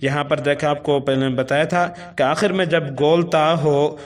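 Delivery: ordinary talk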